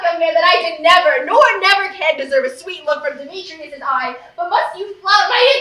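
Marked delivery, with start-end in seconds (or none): none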